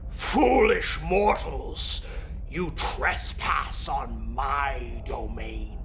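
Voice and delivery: slow, booming dragon voice; bellowing dragon. Acted. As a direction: bellow down at the puny mortals. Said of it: "it speaks in a bellowing voice".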